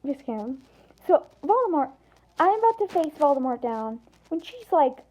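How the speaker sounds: plain reading voice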